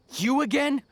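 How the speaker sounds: high, loud, irritated disbelief; exasperated surprise. Acted. Merchant spotting Hatham again, loud exasperated surprise. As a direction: groan it at an unwanted pest. Merchant sees the scholar return.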